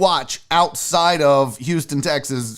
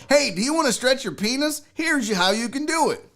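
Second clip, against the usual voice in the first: YouTuber voice; 6 semitones higher than usual. Retold or imitated. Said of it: "they're like".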